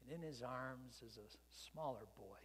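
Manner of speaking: normal talking voice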